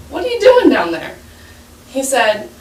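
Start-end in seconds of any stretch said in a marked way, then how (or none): 0.1-1.1 high-pitched mock puzzled question; acted-out query, rising high then dropping; puzzled call down. Doctor calls down to a man in a hole, puzzled.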